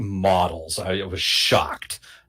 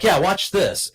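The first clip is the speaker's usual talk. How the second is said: put-on cocky teen boast; 10 semitones higher, 4 dB louder, slower than usual.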